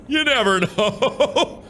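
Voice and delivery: giggling through the words; chuckling at own joke; laughing. Real, not acted.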